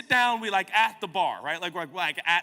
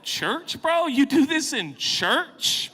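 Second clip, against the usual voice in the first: slow, loud, much higher question; preacher's challenge; 11 semitones higher, 6 dB louder, much slower than usual. Real, not acted. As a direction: stretch it out and hit do hard. Preaching at the congregation.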